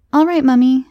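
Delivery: put-on high, loud little-girl reply; girl answering her mother, high and loud; eager child. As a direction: chirp it brightly as a child.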